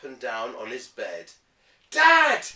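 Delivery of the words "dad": loud high call for Dad; child yelling for Dad. Acted. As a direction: shout it up the stairs. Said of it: "he yelled".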